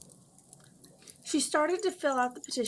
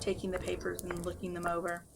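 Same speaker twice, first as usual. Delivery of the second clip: usual speaking voice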